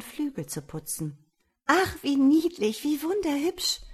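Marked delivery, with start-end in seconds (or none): 1.7-3.8 put-on high, delighted young woman; entzückter Ausruf. A narrator's reading. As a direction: exclaim high and delighted at something adorable. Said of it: "rief das junge Weib und schlug in die Hände". (hell entzückt)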